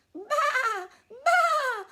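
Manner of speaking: high, loud lamb bleat. A narrator's reading. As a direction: bleat like a frightened little lamb. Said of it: "Borreguita bleated".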